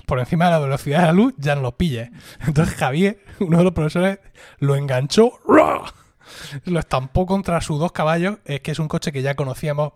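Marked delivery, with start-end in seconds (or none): 5.5-5.8 sound effect shout. Retold or imitated. Retold sudden grab sound.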